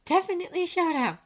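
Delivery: high, certain feminine voice; agreeing woman colleague. A narrator's reading. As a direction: agree knowingly, pitched well up. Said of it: "she agreed".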